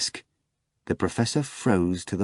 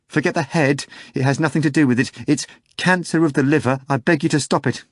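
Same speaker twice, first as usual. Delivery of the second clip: insistent pleading; 6 semitones higher, 3 dB louder than usual. A narrator's reading. Agitated bartender insisting.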